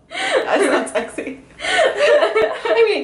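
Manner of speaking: high, laughing protest. Real, not acted.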